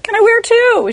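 high and loud mock innocence; loud, high playful jab; cheeky high question. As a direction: ask sweetly to needle the nurse.

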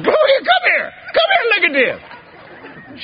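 high excited call across the house. Retold or imitated. Calling his wife from the bedroom, high and laughing. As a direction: shout for the wife to come look, half laughing.

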